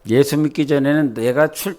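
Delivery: normal talking voice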